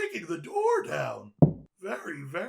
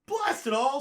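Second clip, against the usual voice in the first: angry outburst; 7 semitones higher, 5 dB louder than usual. Acted. Frustrated gamer cursing.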